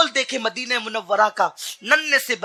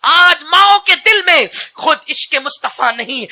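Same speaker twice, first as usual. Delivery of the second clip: high, loud fiery sermon; preaching at full force; 5 semitones higher, 6 dB louder than usual. Acted. Preacher urging parents, roaring high and loud. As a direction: build the crowd with a roar.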